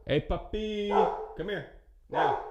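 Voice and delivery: high sing-song coaxing call to the dog; sweet pet voice. Real, not acted. Owner calling puppy mid-vlog, high sweet coaxing voice. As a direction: coo at the puppy to come over.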